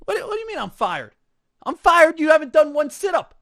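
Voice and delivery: high, loud outraged disbelief; mock outraged client; indignant protest. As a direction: protest like you can't believe you're fired. Fired client protests to the trainer.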